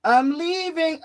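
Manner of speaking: put-on a stubborn old woman storming off; huffy old lady. Old lady voiced again.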